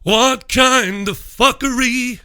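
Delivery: hammed-up incredulity; mock outrage. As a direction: stretch it out in disbelief. Repeats the catchphrase.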